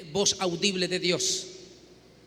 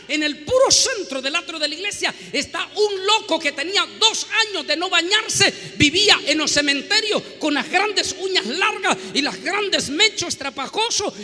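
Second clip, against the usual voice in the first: rising dramatic telling; 7 semitones higher, 5 dB louder than usual. Acted. Preacher builds dramatic description.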